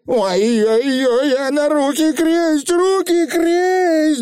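очень высокий протяжный вой коротышки; вопи в ужасе, растягивая слова; панический вопль. A narrator's reading. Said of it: "завопил Туп Вулли". (панический вопль)

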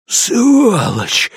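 a high, hissed snarl; hissed curse. A narrator's reading. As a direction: spit the insult out. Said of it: "прошипел хвост". (злобное шипение)

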